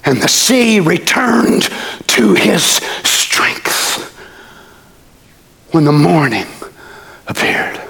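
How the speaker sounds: slow, half-whispered reading of scripture; hushed preacher reading. Acted.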